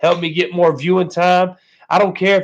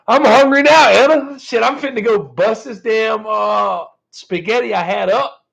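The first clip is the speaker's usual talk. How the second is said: higher and louder, laughing hungry call; excited hungry outburst; 6 semitones higher, 4 dB louder than usual. Real, not acted. Tells Anna he's off to eat, excited burst. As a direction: announce you're off to eat, bursting.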